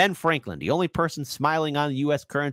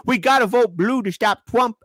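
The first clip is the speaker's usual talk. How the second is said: high, louder mock celebrity plea; 8 semitones higher, 4 dB louder than usual. Mocking Hollywood actors urging fans to vote, high sneering voice. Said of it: "actors and actresses saying".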